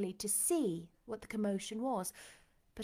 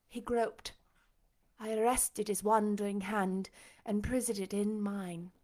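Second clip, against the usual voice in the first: ordinary delivery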